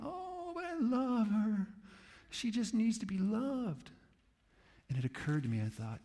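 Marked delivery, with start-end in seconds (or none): 0.0-3.9 much higher, slow, doting plea; hammed-up cat lady begging; pleading cat lady. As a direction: beg like the cat is your baby.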